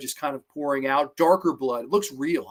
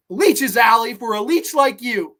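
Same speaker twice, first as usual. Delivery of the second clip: higher, louder villain's taunt; vicious taunt; 6 semitones higher, 6 dB louder than usual. Acted. Angela taunting Allie with leeches, vicious. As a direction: deliver it as a loud, raised taunt. Killer's gloating punch line.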